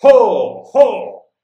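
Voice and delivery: very loud, high shout. Acted. Corrado shouts at the sleeping cranes by the river, very loud high shout. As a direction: bellow Ho ho across the river to scare the birds. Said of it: "gridò".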